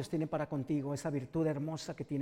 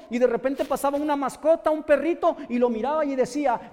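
louder, higher impassioned preaching; preaching at full force; 7 semitones higher, 10 dB louder than usual. Acted. Preacher tells the congregation of Bartimeo, fervent loud preaching. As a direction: build the sermon to a fervent climax.

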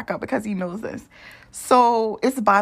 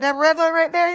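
surprised question; 8 semitones higher, 4 dB louder than usual. Retold or imitated. Her reply about the sauce.

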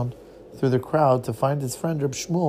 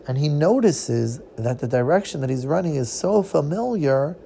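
plain reading voice

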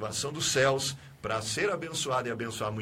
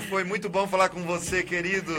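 loud, laughing glória, higher, slow at the end; joyful laughing praise; 5 semitones higher, 7 dB louder than usual. Real, not acted. Pastor happily thanks the caller on air, laughing loudly. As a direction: burst out in happy praise as the call ends.

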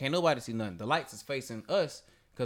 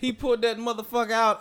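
usual speaking voice

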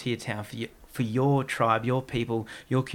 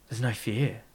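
ordinary delivery

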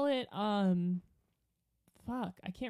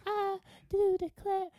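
high-pitched mock Southern accent; hammed-up Southern belle accent; Savannah Southern accent; 11 semitones higher than usual. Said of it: "when he's from Savannah".

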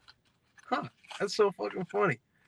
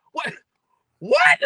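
high, loud double what; a startled squeal of what; shocked disbelief; 9 dB louder than usual. Retold or imitated. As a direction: blurt it out in total disbelief. Seeing fat dude on scooter.

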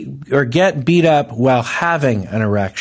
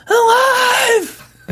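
drawn-out, very high scream; imitated scream. Mimics the guy in the trailer, high-pitched scream. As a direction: scream it like a stranded survivor. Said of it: "just screaming".

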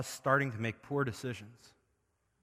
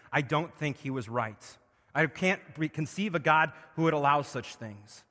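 loud, high, building revival sermon; full-force preaching; 4 semitones higher, 9 dB louder than usual. Acted. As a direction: preach it out with rising fire. Preaching climax over scripture.

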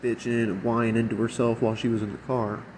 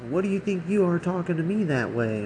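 higher-pitched retold outburst; mock indignant snap, half-hearted; losing it; 6 semitones higher than usual. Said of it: "she lost it".

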